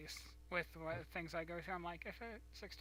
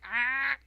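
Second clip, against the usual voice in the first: 1 startled yelp; 8 semitones higher, 16 dB louder than usual. Retold or imitated. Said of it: "I'm like".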